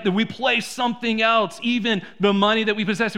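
usual speaking voice

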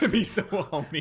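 giggling through the song line; laughing. Retold or imitated.

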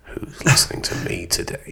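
unvoiced eerie whisper. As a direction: whisper it like a creepy secret. Creepy imagined thought at night.

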